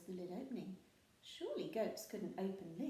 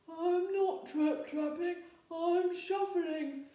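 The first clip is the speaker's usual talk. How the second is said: small voice; 10 semitones higher, 10 dB louder, slower than usual. A narrator's reading. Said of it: "in a very small voice".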